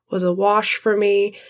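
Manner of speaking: ordinary delivery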